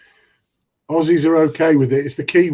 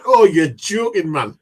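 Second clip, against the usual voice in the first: put-on Geordie accent; 3 semitones higher, 5 dB louder, slower than usual. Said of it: "speaking in a Geordie accent".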